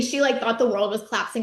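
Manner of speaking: ordinary talk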